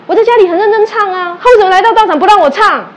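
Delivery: acting out the student's angry protest; indignant complaint. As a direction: complain like she's been wronged. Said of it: "你跟他发脾气干嘛".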